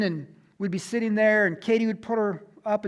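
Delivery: usual speaking voice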